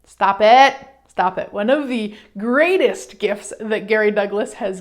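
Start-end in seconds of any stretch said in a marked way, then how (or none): 0.0-0.8 loud, firm order to cut it out; firm command. Acted. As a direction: put a sudden, forceful stop to it. Cutting off the urge to correct.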